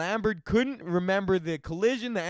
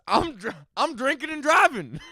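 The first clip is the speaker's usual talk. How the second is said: put-on drunk confessor; 6 semitones higher than usual. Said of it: "man who told police".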